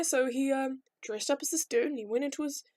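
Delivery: ordinary talk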